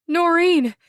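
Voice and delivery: high loud call. Acted. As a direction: call her name across the room. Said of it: "she calls".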